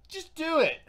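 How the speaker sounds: high-pitched mock urging, laugh at the edge; pushy voice of people egging you on. People egging the speaker into politics, mock urging.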